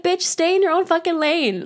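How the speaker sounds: high, sassy self-scolding; high-pitched scolding of herself; scolding myself. Real, not acted. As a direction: tell yourself off with sass. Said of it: "me to myself".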